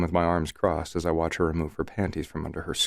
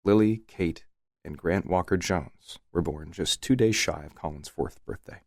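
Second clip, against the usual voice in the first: plain reading voice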